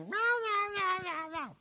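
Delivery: high repeated meows; cat meowing. Retold or imitated. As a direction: meow over and over like a cat. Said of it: "I don't sit there going".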